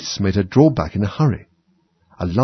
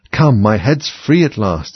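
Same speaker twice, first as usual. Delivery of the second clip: plain reading voice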